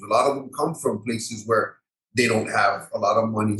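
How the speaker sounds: ordinary delivery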